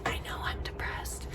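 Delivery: a sudden unvoiced whisper of a confession. Admitting to friends she's depressed, whispered. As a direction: drop to a breathy secret whisper. Quoting what she tells people.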